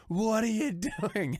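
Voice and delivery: high, drawn-out mock disbelief; incredulous demand. Crackhead confronts flatmate flushing drugs.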